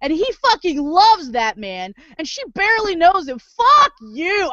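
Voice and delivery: high, climbing fury to a final curse; real outraged rant. Real, not acted. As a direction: build the anger until the curse. Ranting at hated character.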